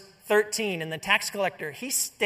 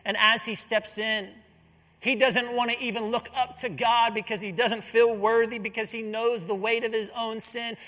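usual speaking voice

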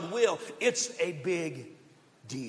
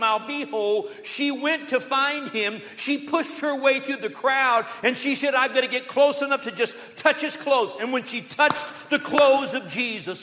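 loud, driving sermon build; preaching at full force; 3 semitones higher, 5 dB louder than usual. Acted. Pastor stirring the church, preaching at full force. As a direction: preach it out to the congregation.

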